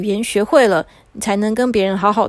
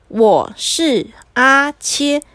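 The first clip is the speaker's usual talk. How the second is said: very slow, each syllable separated; deliberate coaching, one syllable at a time; word by word; 3 semitones higher, much slower than usual. Acted. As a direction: sound it out for a child to repeat. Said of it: "我们一个一个字讲".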